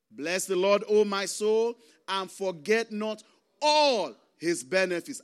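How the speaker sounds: loud, declaimed psalm verse; emphatic scripture reading. Real, not acted. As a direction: proclaim it to the congregation, stretching the key word. Reads the psalm to the congregation.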